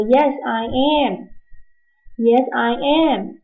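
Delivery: slow and clear; slow classroom demo; slow model answer. Shown as a demonstration. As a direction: spell it out for the class. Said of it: "我说".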